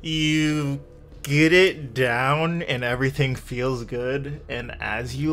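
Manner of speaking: ordinary talk, nothing marked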